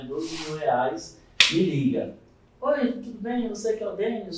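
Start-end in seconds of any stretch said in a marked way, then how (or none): 2.6-4.4 friendly higher-pitched phone greeting; a hint of an upbeat caller; lightly voiced eager caller. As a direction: play a keen recruiter opening a call.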